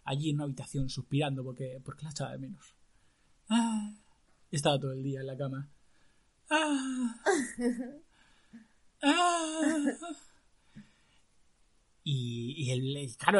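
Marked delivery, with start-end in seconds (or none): 3.5-9.8 high, drawn-out lovesick sighs. Acted. Sick prince sighs for his love. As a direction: sigh like a moping prince.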